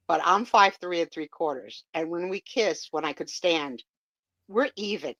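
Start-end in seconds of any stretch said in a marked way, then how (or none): none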